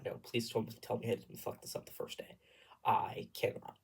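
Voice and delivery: whispered inner plea; hushed dread. Retold or imitated. Terrified singer on first day, re-enacted whispered plea. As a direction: plead softly under your breath. Retelling terrified private thought.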